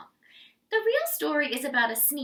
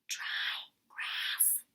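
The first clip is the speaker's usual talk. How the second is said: whispering; 9 dB quieter than usual. A narrator's reading. Aside to kids, whispered.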